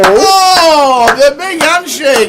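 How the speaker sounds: very high, loud cry of disbelief; excited outburst. Real, not acted. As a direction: shout the winning card in wild excitement. Commentating on the winning river card.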